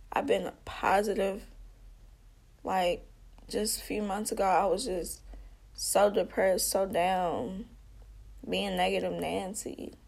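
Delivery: ordinary delivery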